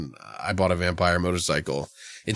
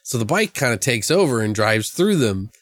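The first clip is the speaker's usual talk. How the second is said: ordinary delivery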